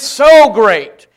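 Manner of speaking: SO leaned on hard before great; stress on SO. Shown as a demonstration. Preacher shows emphatic word, stressing SO. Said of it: "so is an emphatic word".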